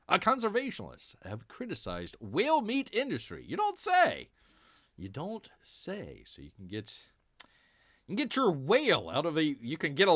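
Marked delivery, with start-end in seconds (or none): 3.5-4.3 high, mock-amazed sarcastic "You don't say.". Sarcastic reply to news item. 5.0-6.2 deadpan. Acted. Repeats the sarcastic reply.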